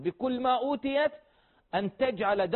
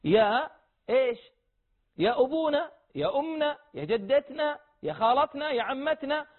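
higher, lightly voiced kids calling out to relatives; a hint of children addressing elders; mild mock of kids calling their family; 5 semitones higher than usual. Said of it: "نطالب عيالنا ونقول لهم".

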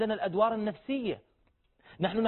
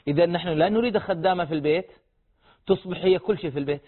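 ordinary delivery

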